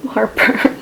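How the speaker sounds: breathy whispered word. Real, not acted. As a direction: whisper it with a little rise. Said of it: "heartburn right now".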